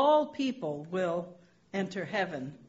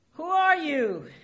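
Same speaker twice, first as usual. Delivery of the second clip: higher, louder shout upward; calling up; 8 semitones higher, 6 dB louder than usual. Retold or imitated. Dangling man shouts up asking who speaks, higher and louder. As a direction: yell the question up the cliff. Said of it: "and says".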